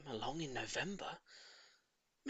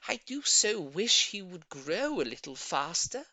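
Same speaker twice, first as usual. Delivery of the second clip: slow, louder wishing; wistful old lady; 5 semitones higher, 6 dB louder than usual. A narrator's reading. Old lady wishing her tortoise would grow, slow wistful. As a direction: sigh the wish out. Mrs Silver wishes.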